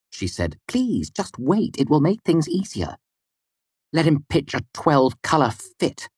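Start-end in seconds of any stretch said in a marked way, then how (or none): 0.7-3.0 pleading woman. A narrator's reading. Mola begs her companion to wait, high and pleading. 3.9-6.1 high, dismissive woman; female voice. A narrator's reading. Woman brushes off someone's coming fit, high and dismissive. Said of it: "a familiar female voice came out".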